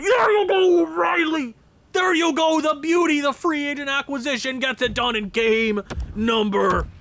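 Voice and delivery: high, loud celebration; ecstatic goal call. Real, not acted. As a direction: scream it like a fan after a goal.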